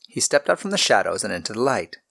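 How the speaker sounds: ordinary delivery, nothing marked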